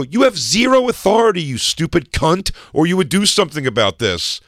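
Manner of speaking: high, loud reenacted tirade; angry tirade. Fan challenging the lady's authority, retold angry tirade. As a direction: tear into her, as retold. Acted quarrel with security lady.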